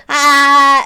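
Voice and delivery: loud, drawn-out "ah" war cry; mock raid yell. Shown as a demonstration. Streamer demonstrating the raid emote, loud mock yell. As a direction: let out a little charge yell. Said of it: "do the little, like".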